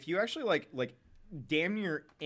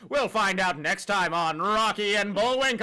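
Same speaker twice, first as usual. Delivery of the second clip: loud, higher announcer sign-off; cartoon narrator; 8 semitones higher, 10 dB louder than usual. Shown as a demonstration. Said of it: "narrator voice".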